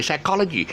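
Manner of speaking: teacher's correct "psychology", high on the second syllable; stress on second syllable. Shown as a demonstration. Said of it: "wrong syllable".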